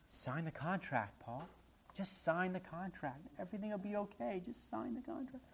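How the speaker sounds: soft, slow, rising coax; coaxing hush. Retold or imitated. Recruiters urging Paul to sign, soft and slow. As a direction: lull him into signing.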